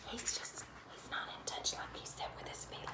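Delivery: soft, breathy whisper; whispered aside. Real, not acted. Mother whispering to her child about the grumpy boy, hushed. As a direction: whisper it behind a hand.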